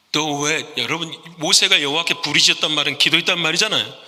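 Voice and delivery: ordinary delivery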